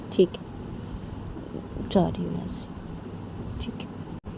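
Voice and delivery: whispered, defeated giving in; hurt whisper. Acted. Bani giving up and leaving, hurt whisper. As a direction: barely breathe it out, wounded.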